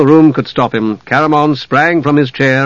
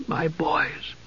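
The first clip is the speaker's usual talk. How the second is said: hushed whisper of grief; faint, stricken whisper; 4 semitones higher, 14 dB quieter than usual. A narrator's reading. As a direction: whisper it, stunned by loss. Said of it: "he whispered".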